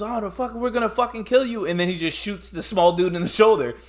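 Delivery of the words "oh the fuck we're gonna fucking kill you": mock threat. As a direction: squeak out a tough-guy threat. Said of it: "the little dude's".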